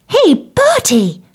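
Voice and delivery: high, slow, eager little call; excited tadpole call. Acted. Little Tim calling excitedly to Bertie on the pond, high and eager. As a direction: call out to the frog, thrilled. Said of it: "Tim called out excitedly".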